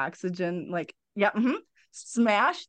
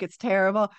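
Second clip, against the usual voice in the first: usual speaking voice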